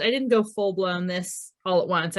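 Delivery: ordinary talk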